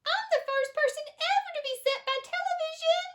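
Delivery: put-on very high, squeaky tiny-boy voice; tiny little voice. A narrator's reading. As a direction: squeak it like a mouse-sized boy. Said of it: "A tiny little voice".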